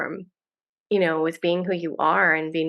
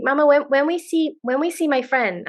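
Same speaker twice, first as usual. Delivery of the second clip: lightly voiced child, a touch higher; little daughter; 7 semitones higher than usual. Mom telling the podcast hosts about her daughter, a light child voice. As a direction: imitate a small girl asking eagerly.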